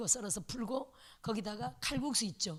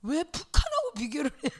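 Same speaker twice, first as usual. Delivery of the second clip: indignant retort; 5 semitones higher, 6 dB louder than usual. Acted. Rebutting the elder now.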